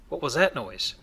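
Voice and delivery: ordinary talk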